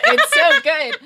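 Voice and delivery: bursting into laughter through the words; laughing. Real, not acted. Friend laughing while recalling the Matt Lauer book, laughing. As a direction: laugh it out, delighted.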